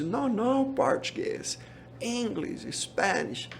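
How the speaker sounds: high, sharp no, brushing off Portuguese; irritated refusal. Retold or imitated. Immigration agent waving off Portuguese, mildly irritated. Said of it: "ele se irritou muito".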